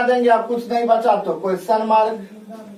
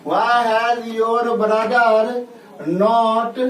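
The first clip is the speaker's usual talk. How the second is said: drawn-out model phrase; much slower than usual. Shown as a demonstration. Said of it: "drawn-out English model".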